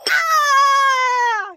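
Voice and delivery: high loud shriek; startled scream. Acted. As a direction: let out a sharp scream. Character startled by the panther.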